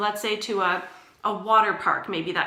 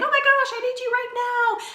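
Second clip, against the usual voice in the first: high, loud mock-panicked cry; hammed-up panic, pitched way up; frantic; 5 dB louder than usual. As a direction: act out a frantic client calling for help. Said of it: "call us frantically and saying".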